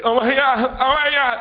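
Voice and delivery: high, loud retold scream of panic; panicked screaming. Brother running round the yard with dart in head, screaming. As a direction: imitate a brother shrieking in alarm. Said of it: "he's running around screaming".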